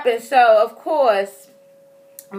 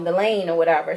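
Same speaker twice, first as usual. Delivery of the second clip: normal talking voice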